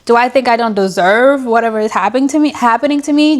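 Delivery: ordinary talk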